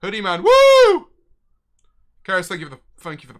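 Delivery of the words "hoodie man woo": high, loud woo; shouted cheer for Hoodie man; excited cheer. Real, not acted. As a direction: whoop it out like hyping the crowd. Cheering a chat name.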